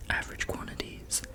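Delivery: whispered Average quantities; hushed reading of the label; ASMR whisper. Acted. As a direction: breathe Average quantities softly.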